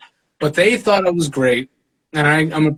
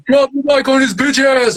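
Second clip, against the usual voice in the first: higher, jeering crowd call; heckler in the crowd; 7 semitones higher than usual. Retold or imitated. Heckler urging the rival on, raised jeering voice. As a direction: taunt like a rowdy spectator. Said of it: "somebody in the fucking crowd goes".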